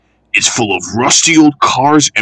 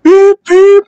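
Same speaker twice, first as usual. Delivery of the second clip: high piercing engine whistle beeps; 8 dB louder than usual. Acted. Edward calling his late passengers with a high whistle. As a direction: beep like a steam engine whistle.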